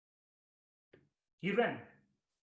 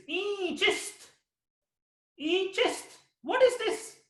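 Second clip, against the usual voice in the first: awestruck cry; 11 semitones higher, 6 dB louder, slower than usual. Acted. Rumi sees the miracle.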